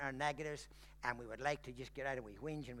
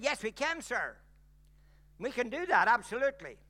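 hint of eager shop girl; 7 semitones higher, 8 dB louder than usual. Shop girl voiced brightly.

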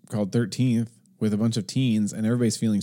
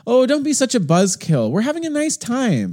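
much higher, louder mock of a cheery dismisser; mocking dismissive person; 13 semitones higher, 5 dB louder than usual. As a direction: squeak it like someone brushing anger off. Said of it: "people are like".

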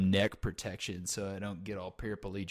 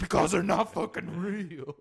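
mock exasperated; 7 semitones higher, 4 dB louder than usual. Host snaps at guest.

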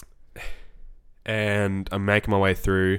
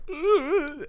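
high strangled groan of overwhelm; 4 dB quieter than usual. Retold or imitated. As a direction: let out a pained high ugh. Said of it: "and I was like".

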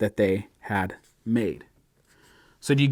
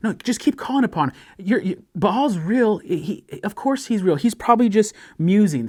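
high, mock-earnest taunt; sarcastic; 7 semitones higher than usual. Preacher voicing Elijah's sarcasm to the prophets, high mocking voice. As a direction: play along as if Baal's real, higher. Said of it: "You see the sarcasm".

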